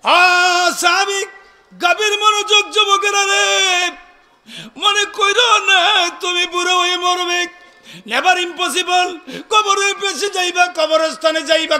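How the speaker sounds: high, loud chanted exhortation; full-force preaching. Real, not acted. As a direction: draw out the calls and ring them out to the crowd.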